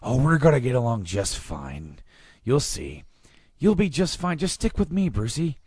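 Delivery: swooping, high-pitched singsong villain voice; Joker. Acted. Joker greeting Bruce in the asylum, playfully sinister. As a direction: coax him along with playful swings in pitch. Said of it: "It's Joker".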